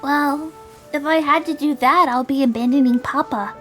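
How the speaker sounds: put-on high, insistent young character; earnest girl. Voices Julie in dialogue.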